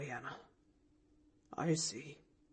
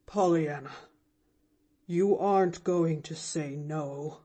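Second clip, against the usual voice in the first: deep with emotion; 3 semitones lower than usual. A narrator's reading. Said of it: "a voice deep with emotion".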